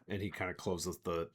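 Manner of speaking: normal talking voice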